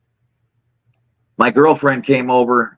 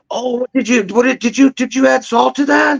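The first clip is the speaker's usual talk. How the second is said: high-pitched mock-appalled questioning; appalled girlfriend; 10 semitones higher than usual. Girlfriend questions cook about salting ham, mock appalled. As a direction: imitate her stammering disbelief, pitched high.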